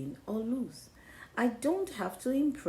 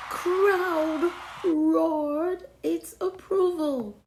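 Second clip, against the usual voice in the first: roaring crowd; 7 semitones higher, 8 dB louder, much slower than usual. A narrator's reading. Crowd cheering narrated.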